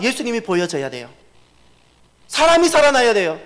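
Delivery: high, loud, impassioned sermon cry; fervent pulpit shout; preaching at full force. Acted. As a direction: drive the point home to the congregation.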